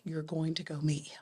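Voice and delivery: hushed, breathy whisper of the board. Retold or imitated. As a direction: whisper it like an insistent secret. Board insisting she meet him.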